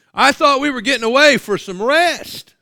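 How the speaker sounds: high, loud dismayed cry of the disciples; tired disciples dismayed to find the crowd waiting; shocked disciples. Acted. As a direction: blurt it out as the weary disciples seeing the crowd.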